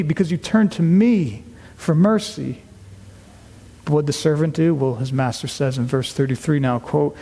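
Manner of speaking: normal talking voice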